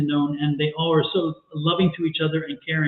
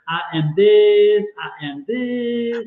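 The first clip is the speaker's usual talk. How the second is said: put-on very slow, higher, childlike; little girl voice; 4 semitones higher, 4 dB louder, much slower than usual. Quoting the little girl from The Help, slow and childlike. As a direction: spell it out slowly like a small girl repeating a lesson. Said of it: "the little girl's like".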